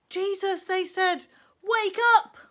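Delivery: high, loud frightened cry; panicked call. Acted. Frightened fishermen waking Jesus, high loud panic. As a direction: call out in alarm.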